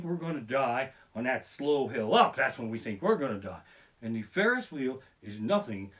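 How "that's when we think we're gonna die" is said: ordinary delivery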